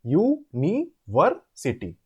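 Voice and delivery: "University" slowly, a pause after each part; four separate syllables. Shown as a demonstration. Teacher counting four syllables, "University" slowly split into four parts. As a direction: sound out "University" as yu, ni, ver, sity. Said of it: "Four syllables".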